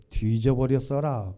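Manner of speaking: ordinary talk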